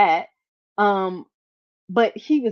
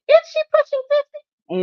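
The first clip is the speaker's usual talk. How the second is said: much higher, louder catty question; high mocking commenter; 6 dB louder than usual. Host mocking a commenter, high catty question. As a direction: sneer the question in a shrill mock voice. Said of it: "They said".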